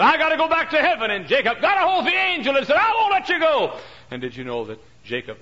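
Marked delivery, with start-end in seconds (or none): 0.0-3.8 high, loud shouted lines; loud dramatic voices. Acted. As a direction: shout the struggle between them. Angel and Jacob wrestling dialogue.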